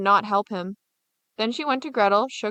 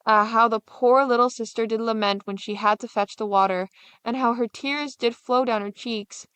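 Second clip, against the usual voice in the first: usual speaking voice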